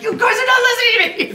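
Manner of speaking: imitating a frustrated guest nobody listens to; frustrated whine. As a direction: whine at friends who ignore you. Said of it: "He was so frustrated 'cause he's like".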